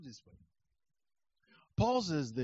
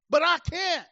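emphatic outburst; 12 semitones higher, 10 dB louder than usual. Real, not acted. Sudden high exclamation.

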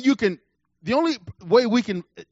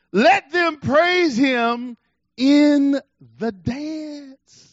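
slow, loud, stretched verse; drawn-out proclamation; 4 semitones higher, 4 dB louder, much slower than usual. Shown as a demonstration. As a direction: stretch each word out to the crowd. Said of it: "preacher drawing out the verse".

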